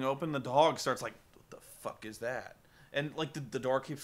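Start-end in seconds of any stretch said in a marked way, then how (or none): 1.3-2.6 startled dog. Retold or imitated. Dog voiced, door blowing open.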